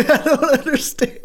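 high, loud laughing words; laughing in disbelief. Real, not acted. Riffing on all these guys falling for her, laughing in disbelief. Cracking up over the film.

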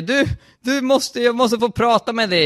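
high, pressing appeal; desperate request, acted out; urgent plea.

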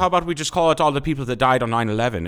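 put-on high, loud stern Irish accent; a firm Irish bartender putting them in place. The Irish bartender scolding customers for their drink order, stern Irish accent. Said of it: "he would sound like that".

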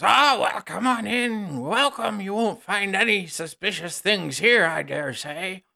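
high, folksy host covering up a secret; nervous villager. Acted. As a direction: bluff innocence, stammering, in a high rustic voice. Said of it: "another voice goes".

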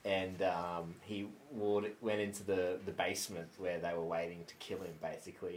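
ordinary talk

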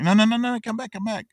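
high, loud plea to come back; hammed-up Scrooge calling after them; mock calling out. Scrooge calling after the fleeing charity men, hammed-up high plea.

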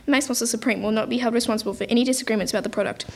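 rushed small-print read; fast disclaimer. Acted. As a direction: gabble the legal small print. Terms and conditions disclaimer.

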